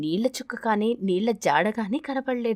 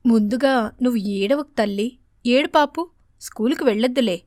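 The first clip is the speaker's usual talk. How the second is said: soothing mother; 4 dB louder than usual. Acted. Mother soothing crying daughter.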